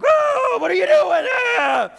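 very high, loud, hammed-up yelling; mock outraged shout. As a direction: shriek it like a comic wrathful God. Mock angry God throwing planets at sinners.

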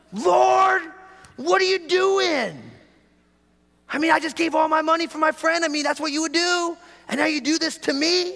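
hammed-up indignant complaint to God; mad at God. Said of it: "I got mad at God".

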